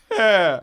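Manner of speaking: single high, loud "eh" laugh; grandma's laugh starting. Retold or imitated. Grandson imitating grandma's contagious laugh, one loud opening burst. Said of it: "it would start real simple".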